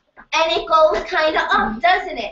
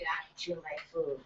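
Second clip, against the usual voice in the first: grumbling retort; 10 semitones lower, 17 dB quieter, faster than usual. Retold or imitated. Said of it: "he looked at me angry".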